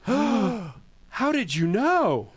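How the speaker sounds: high, slow, drawn-out gasping question; shocked disbelief. Pretend. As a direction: play a stunned victim caught out. Mocking user caught with weak password.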